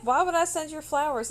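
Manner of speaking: high, loud incredulous comeback; imitating a baffled coworker; retold baffled retort. Coworker's indignant reply, acted out.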